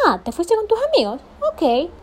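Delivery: high, loud, snippy sarcastic ok; sarcastic and haughty. Retold or imitated. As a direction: needle your boyfriend with fake indifference. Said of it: "yo era brava y altanera".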